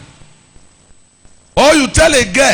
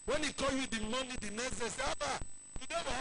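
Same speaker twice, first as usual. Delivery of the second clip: faint retold call; 3 semitones higher, 26 dB quieter, faster than usual.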